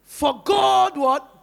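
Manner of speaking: slow, loud prompt left hanging; drawn-out prompt. Shown as a demonstration. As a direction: stretch it out, demanding the answer. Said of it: "So now let's read it".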